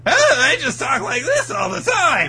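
very high, loud whiny Usopp; Usopp impression. Doing an easy Usopp impression, very high and whiny. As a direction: squeal it like a cartoon coward. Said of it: "Is Usopp".

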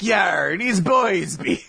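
drawn-out pirate voice; Sasaki-san. Acted. Joking that Shonen Jump is listening, pirate impression. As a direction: play a hammed-up pirate, stretching the words. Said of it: "He's a pirate".

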